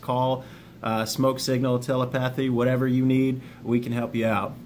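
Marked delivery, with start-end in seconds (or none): none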